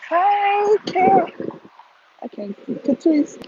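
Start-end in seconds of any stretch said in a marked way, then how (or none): 0.1-1.3 loud, high call across distance. Real, not acted. Calling out to a friend. 2.8-3.5 calling out. Real, not acted. Calling out to a friend.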